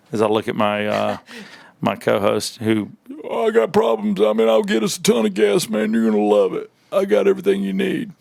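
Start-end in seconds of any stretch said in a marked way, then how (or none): 3.2-8.1 co-host's late-night call as Bill Clinton; Bill Clinton impression. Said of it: "It sounds like Bill Clinton".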